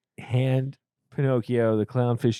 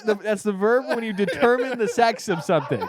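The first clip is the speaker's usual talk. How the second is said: laughing; 9 semitones higher than usual. Real, not acted. Laughing explanation.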